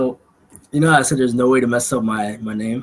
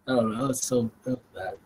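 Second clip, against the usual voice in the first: ordinary delivery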